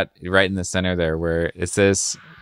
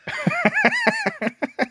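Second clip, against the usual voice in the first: high helpless burst of laughter; shrieking laugh; 4 dB quieter than usual. Real, not acted. Amused by Batman's absurd line, high shrieking laugh.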